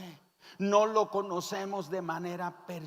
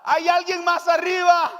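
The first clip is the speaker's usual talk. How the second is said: high, full-voiced shout upward; 13 semitones higher, 11 dB louder than usual. Hanging man shouting up past God, desperate shout. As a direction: yell for another rescuer. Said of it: "comienza a gritar".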